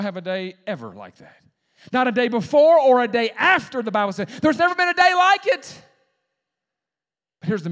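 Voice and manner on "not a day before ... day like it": high, loud preacher's proclamation; full-force preaching. Real, not acted. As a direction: build to a ringing shout on the last phrase.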